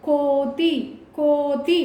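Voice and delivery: "కో" lengthened each time in "కోతి"; drawn-out long vowel. Shown as a demonstration. Teacher saying it twice before writing, long "కో". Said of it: "ఎక్కడ దీర్ఘం తీస్తున్నాము".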